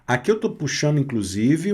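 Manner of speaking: normal talking voice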